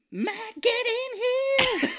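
very high, drawn-out mock screech; mock yell. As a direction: holler for someone in a comic falsetto. Acts heroine yelling for Matt.

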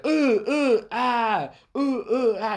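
high, loud, laughing kiai shouts; training grunts. Retold or imitated. Imitating the dojo drill with laughing high grunts. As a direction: bark each strike like in class.

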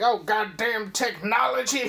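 hammed-up exasperated character voice; imitated technician. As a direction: play a fed-up worker cursing a machine. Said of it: "one of the technicians like".

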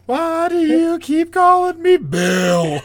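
high, drawn-out mock wail; slow, hammed-up plaintive cry; whining quote impression. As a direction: wail the question in an exaggerated cartoon voice. Repeats the running-joke quote.

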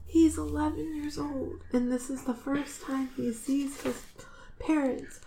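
soft, high, tearful voice; choked up. Real, not acted. Listener aching for an orphaned boy, choked up. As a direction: barely get it out, quietly moved. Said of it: "I'm choked up".

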